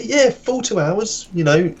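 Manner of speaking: high, slow, drawn-out sales pitch; string him along, savouring each word; mock hype. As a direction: lads hamming up a lie to a gullible mate. Lads selling the fake football lesson.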